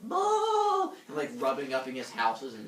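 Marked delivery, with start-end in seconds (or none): 0.0-0.9 high, drawn-out friendly bull call; big happy bull noise, pitched way up; purring friendly bull. Retold or imitated. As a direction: bellow sweetly like a contented bull. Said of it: "like, purring".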